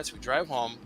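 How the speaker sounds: usual speaking voice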